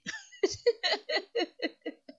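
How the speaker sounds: high evil cackle, loud; villain laugh. Acted. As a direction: cackle like a mustache twirling villain. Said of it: "That's my villain laugh".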